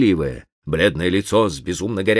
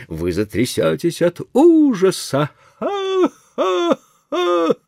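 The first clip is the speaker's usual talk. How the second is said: mad ghost voice; 13 semitones higher, slower than usual. Acted.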